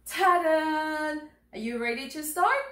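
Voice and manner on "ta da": higher, drawn-out reveal fanfare; sung ta-da. Pretend. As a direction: sing out a ta-da to reveal.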